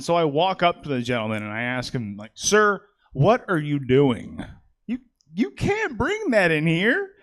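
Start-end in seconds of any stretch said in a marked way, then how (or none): 2.3-7.1 rising, slowing, outraged plea to the gunman; mock confrontation. Comic confronting an armed man at Walmart, rising outraged plea. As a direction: scold him higher and higher till it cracks.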